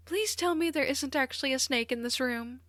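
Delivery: high-pitched anxious plea; high, pleading yelp of a classmate; alarmed yelp. A narrator's reading. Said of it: "Uraka yelped".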